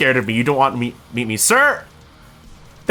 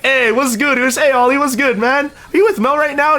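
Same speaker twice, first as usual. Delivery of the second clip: high, louder friendly shout-out; hyped greeting; 10 semitones higher, 3 dB louder than usual. Retold or imitated. Mike greeting Ollie on his stream, loud and high. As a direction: greet your buddy loud and bright.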